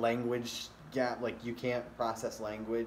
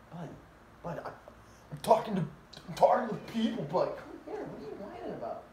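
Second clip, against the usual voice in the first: high pet-talk voice, rising; talking to the dog; 9 semitones higher, 4 dB louder, faster than usual. Real, not acted. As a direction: coo at the whining dog. Scolding then cooing to the dog.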